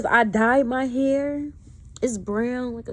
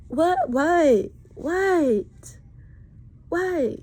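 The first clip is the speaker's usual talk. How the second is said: rising, louder repeated what; incredulous, louder and higher; exasperated what; 6 semitones higher, 4 dB louder, much slower than usual. Real, not acted. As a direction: throw up your hands, fed up. Exasperated outburst at harassers.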